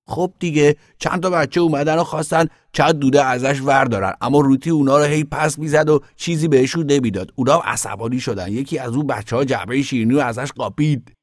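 put-on high, loud child voice; excited little boy telling tales. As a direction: blurt out the story like an eager kid.

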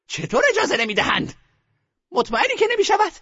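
indignant grandmother. A narrator's reading. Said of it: "مادر بزرگ گفت".

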